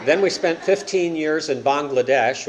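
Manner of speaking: ordinary talk, nothing marked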